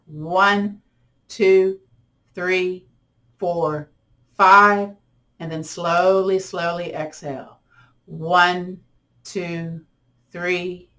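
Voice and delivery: slow, drawn-out counting through each breath; guided breathing count. Acted. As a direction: pace them gently through inhale and exhale. Leads paced breathing with counting.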